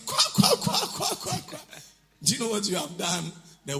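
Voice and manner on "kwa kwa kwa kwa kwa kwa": very high mock cackle, "kwa kwa"; imitated laughter. Preacher mimicking someone laughing off an insult, mock cackle. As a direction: parody a silly laugh in squawks. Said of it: "then you are laughing".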